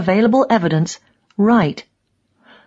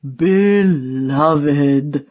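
drawn-out, very slow sarcastic 'so beloved'; old man dripping with sarcasm; heavy sarcasm; much slower than usual. A narrator's reading. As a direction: stretch it out with bitter irony. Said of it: "he says, with heavy sarcasm".

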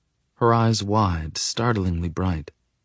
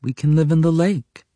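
put-on higher, louder childlike voice; simple and eager; 9 semitones higher, 4 dB louder than usual. A narrator's reading. Little girl to her friend, eager plan. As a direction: say it like an obvious plan. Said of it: "she says simply".

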